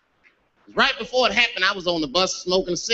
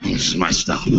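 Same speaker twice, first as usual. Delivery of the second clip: low, deadpan homeless man; comic deep-voiced understatement; back to his senses; 12 semitones lower than usual. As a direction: drop very low and announce it calmly. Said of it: "He came back to his senses".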